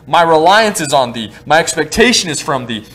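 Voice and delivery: ordinary talk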